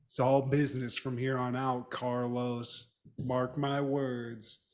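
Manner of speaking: low, soft, hint of hard-boiled cop; mock-grave detective vow. Podcaster as cop vowing to Carlos, low mock-solemn. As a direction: swear it quietly like a movie cop.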